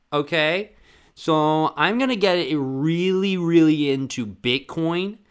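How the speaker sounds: loud, high, slow insistent hype; hammed-up eager pitch to friends; manic pitch. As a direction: sell the wild idea hard. Manic pitch of Bitcoin idea.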